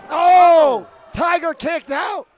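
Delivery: high, loud, slow kickout call; excited shout. Real, not acted. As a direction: roar the near-fall.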